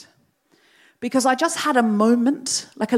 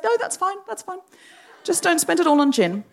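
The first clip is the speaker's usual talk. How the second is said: amused reassurance; 9 semitones higher than usual. Retold or imitated. Deirdre reassures her.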